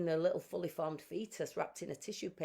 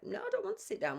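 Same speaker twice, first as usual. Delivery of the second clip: much higher, frantic refusal; panicked refusal; faster than usual. Retold or imitated. Refusing to sit, alarmed.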